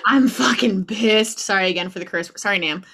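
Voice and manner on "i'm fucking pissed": loud, slow, laughing fury; mock-angry laugh as she imitates her running self; laughing mock rage. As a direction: spit it out laughing at yourself.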